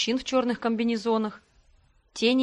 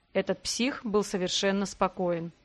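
ordinary delivery

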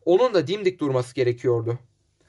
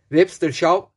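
usual speaking voice

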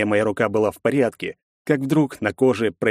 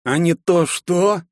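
guttural taunt; 8 semitones higher, slower than usual. Acted. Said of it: "утробно спросил рыжий".

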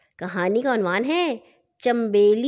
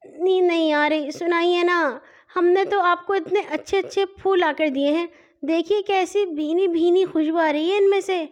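put-on high, childlike little sister; little girl's voice; 6 semitones higher than usual. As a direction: play a cheeky little girl teasing her brother. Nanhi coaxes her brother. (ننھی بچی کی باریک، شوخ آواز)

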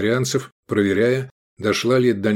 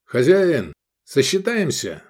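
higher-pitched call for the host; 6 semitones higher, slower than usual. A narrator's reading. Customer calls the innkeeper to settle the bill, calling out. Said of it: "Искандер кивнул и позвал".

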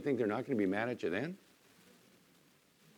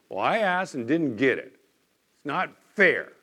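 whiny complainer; 3 semitones higher, 5 dB louder than usual. Retold or imitated. Preacher voices complaining churchgoers.

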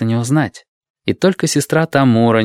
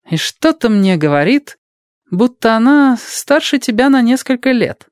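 sly nurse; 14 semitones higher, 3 dB louder than usual. Acted. Tamura guessing slyly.

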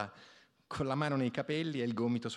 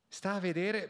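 grumbling; 8 semitones higher than usual. A narrator's reading.

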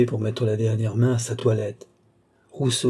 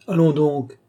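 higher, louder protest; exclaiming; 5 semitones higher, 5 dB louder than usual. A narrator's reading. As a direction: cry out in lively disbelief. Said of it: "s'écria le comte d'Artois". (exclamation de protestation)

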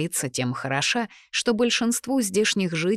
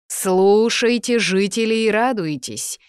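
slow, loud proclamation to the crowd; town crier; 4 semitones higher, 6 dB louder than usual. A narrator's reading. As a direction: call it out like a herald over the market. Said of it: "Усиленный магией голос глашатая". (громкий зычный голос глашатая)

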